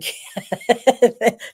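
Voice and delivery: short amused burst of laughter; real laugh. Real, not acted. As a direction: laugh at the stair mishap. Medium laughs at stair story.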